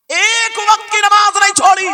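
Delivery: high, shouted sermon declamation; full-force preaching. Real, not acted. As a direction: proclaim it loudly to the crowd.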